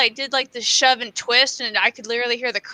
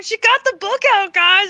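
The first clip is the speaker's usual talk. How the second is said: higher, louder retold boast to the office; excited announcement; 9 semitones higher, 5 dB louder than usual. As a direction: imitate a doctor proudly announcing to everyone. Retold doctor announcing around the office.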